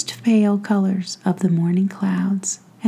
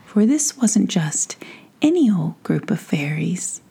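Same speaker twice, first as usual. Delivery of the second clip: plain reading voice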